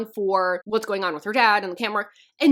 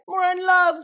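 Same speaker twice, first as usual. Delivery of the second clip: a hammed-up dreamy "we're in love", higher and louder; mock lovestruck; 7 semitones higher, 6 dB louder than usual. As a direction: gush it like a swooning couple. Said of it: "It was just kind of like".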